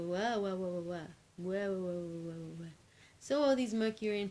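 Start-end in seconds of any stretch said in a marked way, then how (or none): 0.0-2.7 mock baby wailing wah; mock crying wah. Mercurian Queen mock wails at the rival. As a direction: wail like a sulky baby. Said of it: "And she go".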